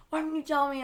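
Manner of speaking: high, tearful retold question; lightly put-on tearful plea; mock slight crying. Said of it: "crying a little".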